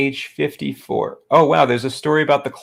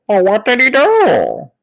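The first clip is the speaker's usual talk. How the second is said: loud, high, drawn-out mock horror; Kent Hovind; 9 semitones higher, 7 dB louder than usual. Kent reacting to the unthinkable, loud hammed-up horror. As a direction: wail the question, hugely overacted. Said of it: "this story is Kent Hovind".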